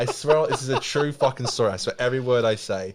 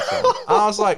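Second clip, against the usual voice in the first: laughing; 7 dB louder, faster than usual. Real, not acted. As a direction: burst of laughter, much higher and louder. Cracking up mid story.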